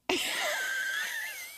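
high shrieking burst of laughter. Real, not acted. Producer laughing, story absurd.

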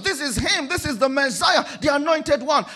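high, loud shouted recognition; awed shout. Retold or imitated. Preacher voicing Israel greeting the Messiah, shouting in awe. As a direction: shout it like you finally see him.

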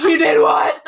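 loud, high mock-furious outburst; hammed-up livid yell. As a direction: explode in outraged disbelief, imitating him. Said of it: "is livid".

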